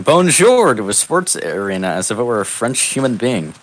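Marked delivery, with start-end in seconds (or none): 0.0-0.7 loud, higher mock yell of "Bonjour". Hero greeting the arena, loud high "Bonjour". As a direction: belt out "Bonjour" at the stadium. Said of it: "the sin of yelling".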